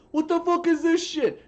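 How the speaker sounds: high, loud, put-on furious yell; mock angry customer. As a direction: storm in yelling about a cracked CD.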